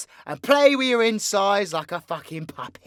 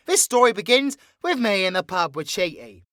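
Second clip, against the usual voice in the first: ordinary delivery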